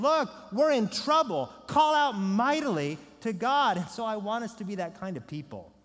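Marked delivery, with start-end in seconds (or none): 0.0-3.8 high, loud king's appeal; rally a whole city in alarm; urgent royal proclamation. Acted. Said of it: "the king of the Ninevites is saying".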